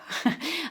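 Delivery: light laugh at her own tears; soft embarrassed laugh. Real, not acted. Reader laughs at herself after tearing up, light laugh. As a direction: laugh it off gently.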